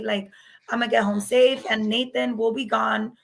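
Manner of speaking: usual speaking voice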